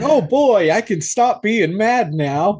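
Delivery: high, loud gleeful burst, then slow; mock relieved glee. Mocking the grieving dad's happy face, high and gleeful. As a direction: ham up the dad's sudden joy.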